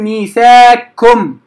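ميثاقكم as miithaakkum, qaf lost into kaf; wrong merged reading. Shown as a demonstration. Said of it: "خطأ".

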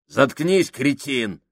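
выше и громче; оборви его резко; злой окрик. Acted. Сакс перебивает Кука. (Сакс обрывает Кука, громко и зло)

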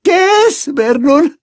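very high, loud panicked cry; high-pitched, frightened plea to her husband; terrified aunt. A narrator's reading. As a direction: shriek the question in terror. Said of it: "miraba aterrorizada la estufa".

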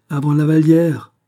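high, loud, stung exclamation; wounded outcry. A narrator's reading. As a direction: cry out in bitter hurt. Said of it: "s'écria la princesse ulcérée".

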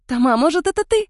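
high, loud giggly teasing question; giggling tease. A narrator's reading. Vitka teases Toma, nudging him with an elbow, giggling. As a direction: needle your partner with a giggle. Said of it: "хихикнула Витка".

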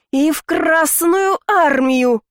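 high, slow roaring shout; yelling. Acted. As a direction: roar the final curse. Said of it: "проорала я на десерт". (проорать во всё горло)

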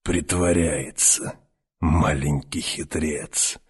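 slow, soft sly crone; a knowing old witch murmuring; old woman voice. Acted. Старуха говорит о мальчике. (медленно и тихо, голосом старухи)

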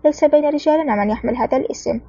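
ordinary delivery

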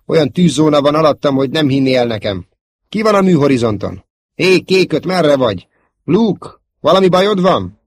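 loud, high-pitched calling out; urgent battle call. Acted. Pilots searching for Luke under heavy fire, urgent. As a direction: call out for a missing wingman.

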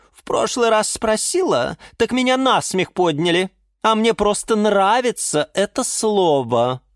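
high, emphatic, offended character voice; huffy orchid. Acted.

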